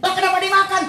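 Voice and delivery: high-pitched acted protest; dismayed mother. Mother finding out child ate the fish, dismayed. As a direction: demand it as a startled mother would.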